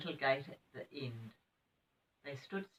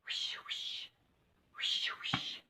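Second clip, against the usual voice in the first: breathy whooshing whisper; whispering trees; much slower than usual. Acted. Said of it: "trees were whispering".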